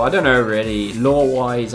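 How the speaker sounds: usual speaking voice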